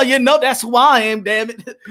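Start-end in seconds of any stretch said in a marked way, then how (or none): none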